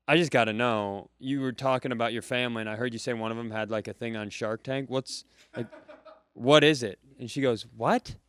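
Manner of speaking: ordinary delivery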